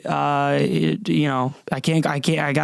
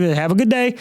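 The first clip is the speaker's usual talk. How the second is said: imitated higher-pitched, light Indian accent; slight Indian accent; 6 semitones higher than usual. Barista sending off a customer, slight Indian accent. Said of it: "he was like".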